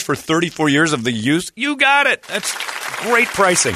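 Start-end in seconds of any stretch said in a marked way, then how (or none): 1.6-3.7 mock cheerful. Mock cheerful acceptance of fee.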